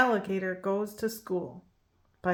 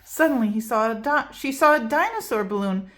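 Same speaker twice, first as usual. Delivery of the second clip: ordinary delivery, nothing marked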